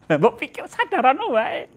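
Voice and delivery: high teasing scold through a laugh; laughing mock scold. Preacher teasing the congregation, laughing scold. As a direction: tease them with a grin.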